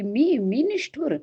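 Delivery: sudden pitch jump in disbelief; startled. A narrator's reading. Radha startled at being called heartless, high disbelief. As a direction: caught off guard, indignant. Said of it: "त्यावर राधा एकदम म्हणाली".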